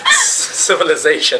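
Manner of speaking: usual speaking voice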